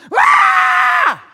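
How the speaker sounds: loud, very high screaming roar; baby roar. Retold or imitated. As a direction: imitate a furious infant's scream. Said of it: "'Cause she's like".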